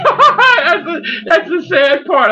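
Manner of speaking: high, loud laugh through the words. Real, not acted. Admitting to a co-host he is serious, real laughter. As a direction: burst out laughing while admitting it.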